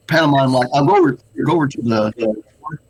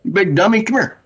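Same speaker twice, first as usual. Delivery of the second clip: higher mocking taunt at the guard; taunting yell; 7 semitones higher than usual. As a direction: goad the guard to come over. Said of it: "yell at the guard".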